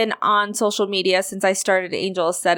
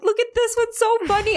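giddy self-amused. Retold or imitated. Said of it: "I'm like".

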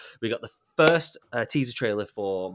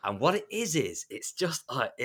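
usual speaking voice

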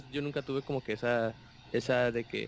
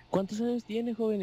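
lady asking; 9 semitones higher than usual. Retold or imitated. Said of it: "me dice la señora".

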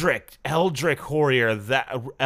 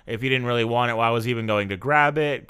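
ordinary talk

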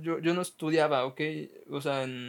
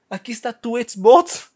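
mock grand announcement; 5 semitones higher, 12 dB louder than usual. Principal imagined giving the prize.

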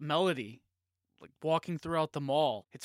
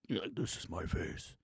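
much lower, softer menacing voice; dark villain voice; 10 semitones lower, 6 dB quieter than usual. Retold or imitated. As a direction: drop into a deep dark movie-villain voice. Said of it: "His voice has changed now and everything".

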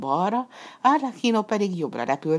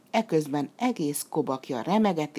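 usual speaking voice

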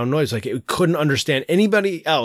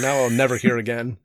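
ordinary talk, nothing marked

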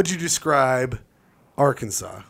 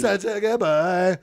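cooing to a pet; 5 semitones higher, slower than usual. Acted. Cooing to the cat.

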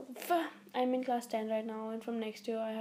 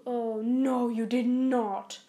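shocked; 8 dB louder, much slower than usual. Real, not acted. Shocked at the assignment.

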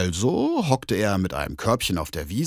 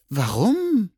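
much higher, mewing cat voice; meowing cat; 12 semitones higher, 3 dB louder than usual. Acted. Cat asks the bear why, in a high mew. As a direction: meow the question. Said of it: "maunzte die Katze".

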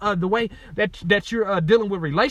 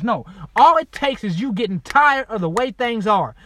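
ordinary delivery

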